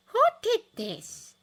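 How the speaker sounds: slow, high, loud outraged demand; furious nurse demanding who did it; raging. Acted. Matron seeing Harry's grey hair, raging slow demand. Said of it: "she raged".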